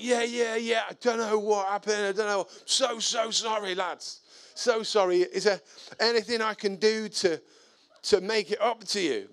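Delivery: high, repetitive pleading; flustered apology. Retold or imitated. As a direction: imitate a caught neighbour falling over himself to apologise. Caught neighbour apologises profusely.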